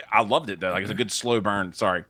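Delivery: ordinary talk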